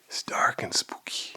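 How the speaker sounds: hushed, eerie whisper; spooky whisper. Acted. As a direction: breathe it like a ghost story. Spooky cave, whispered aside.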